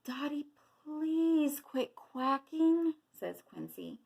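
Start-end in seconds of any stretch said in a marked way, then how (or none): 0.0-3.0 higher, drawn-out plea; pleading quail. A narrator's reading. Quail with aching head begging the duck, pleading slowly. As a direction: beg with a stretched-out "please". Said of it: "till Quincy's head hurts".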